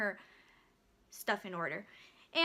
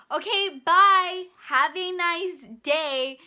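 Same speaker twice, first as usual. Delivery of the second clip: slow, much louder, higher goodbye; yelled mock goodbye; 4 semitones higher, 10 dB louder, much slower than usual. Cashier shouts goodbye over customer, slow and loud. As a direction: drag it out over her yelling. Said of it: "She's yelling".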